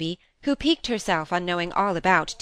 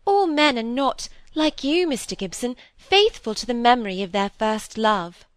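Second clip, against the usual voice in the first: high, earnest pleading; tender piping voice; 5 semitones higher, 4 dB louder than usual. A narrator's reading. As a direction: plead admiringly in a piping little voice. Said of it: "pleaded Miss Phoebe in her tender".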